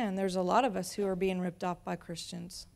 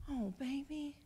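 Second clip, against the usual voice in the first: soothing mom; 4 semitones higher than usual. Retold or imitated. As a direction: comfort a child without thinking. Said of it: "I said".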